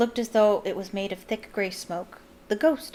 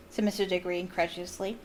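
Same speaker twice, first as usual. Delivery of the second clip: usual speaking voice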